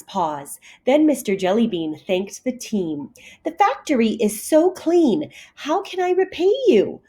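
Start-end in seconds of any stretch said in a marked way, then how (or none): 3.4-7.0 grateful manager. Acted. Manager thanks team.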